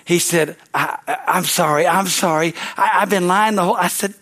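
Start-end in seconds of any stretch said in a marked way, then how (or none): none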